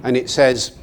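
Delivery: ordinary delivery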